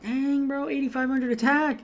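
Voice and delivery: high-pitched, impressed quote of himself; amazed reaction. Retold or imitated. Gamer recalling his reaction to huge attack stats, amazed. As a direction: retell being blown away, pitched well up.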